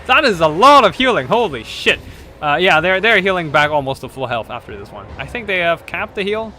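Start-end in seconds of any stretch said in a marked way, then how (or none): 0.1-2.0 loud amazed outburst; surprised. Real, not acted. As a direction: blurt it out, stunned and loud. Huge heal surprises the caster.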